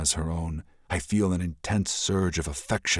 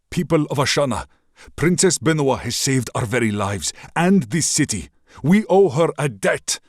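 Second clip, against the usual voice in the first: high, loud speech to the crowd; loud address; 9 semitones higher, 6 dB louder than usual. Acted. Prince rallies the people of Ashana, loudly. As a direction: call out to the whole city. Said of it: "I say loudly".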